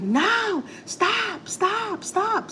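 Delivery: high, strained cries of stop, retold; retold high-pitched screaming. Neighbor woman crying out to her husband, high mock screams. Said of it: "I hear somebody screaming".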